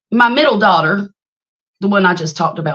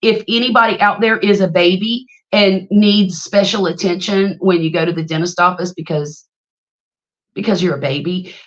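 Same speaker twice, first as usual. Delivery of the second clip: usual speaking voice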